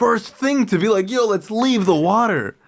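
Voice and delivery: high, drawn-out amazement with laughter; mock stoner epiphany. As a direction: blown away by a silly revelation. Acting out acid-trip epiphany.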